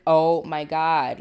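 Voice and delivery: loud, slow, stunned dread; freaking out. Retold or imitated. Relatives hearing about footsteps on the roof, freaking out. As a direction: draw it out in mock alarm. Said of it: "they're freaking out".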